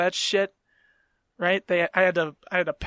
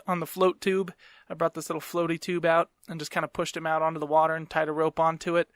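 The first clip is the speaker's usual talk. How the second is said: ordinary talk, nothing marked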